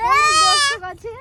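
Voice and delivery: high, loud proud cry about the crowd; gleefully crowing about lots of viewers; excited boast. Real, not acted. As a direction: announce the big audience with a thrill.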